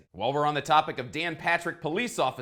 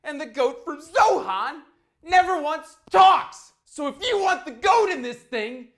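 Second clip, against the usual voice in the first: exasperated rant; 9 semitones higher, 5 dB louder than usual. Real, not acted. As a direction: lecture annoyed commenters, building louder.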